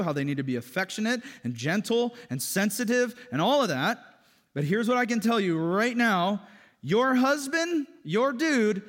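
loud, high, impassioned sermon; full-force preaching. Acted. Pastor telling congregation men and women differ, fervent. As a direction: drive the point home.

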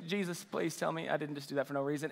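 low, soft retold prayer; hushed plea. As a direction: drop to a quiet, private plea. Retold private plea after the kiss.

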